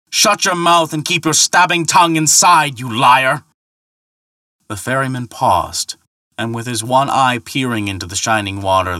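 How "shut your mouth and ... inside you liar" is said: high, loud raging yell; furious shouting. Acted. Thor shouting furiously across the river at Harbard. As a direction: hurl the insult across the water.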